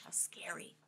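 soft hushed aside; whispering. Real, not acted. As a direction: whisper it like a secret. Reader aside to child.

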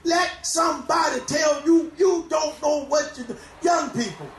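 full-force preaching, shouting over the organ. Real, not acted. Preacher exhorting congregation.

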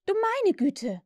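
high-pitched exasperated little mother; put-upon, exclaimed sigh; sighed exasperation. A narrator's reading. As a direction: sigh at the children's chatter. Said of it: "seufzte Wendy".

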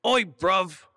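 put-on British accent. Asked by the guest for his British accent, a hammy high British accent. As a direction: play a cartoon London lad in a British accent. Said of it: "Show me your British accent".

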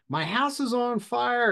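mock scream, pitched high without much volume; high-pitched, toned-down scream; imitated panicked scream. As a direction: retell a woman's frantic cry for help. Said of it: "this woman was screaming into the phone".

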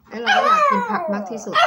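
high, loud burst of complaint; excited. Real, not acted. Sudden high, loud outburst.

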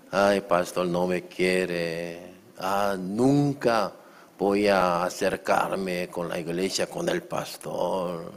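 slow, drawn-out mock complaint; hurt church member. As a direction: drag it out like a wounded churchgoer. (queja dolida, lenta y fingida)

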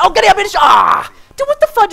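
high, urgent shout breaking into a cry; frantic yell. Real, not acted. Player urging teammate in a firefight, frantic yell. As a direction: yell in the heat of the fight. Frantic moment in game fight.

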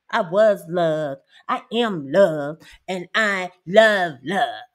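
slow, each word weighed; slow emphatic declaration. Real, not acted. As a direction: land every word deliberately. Declaring she is loved.

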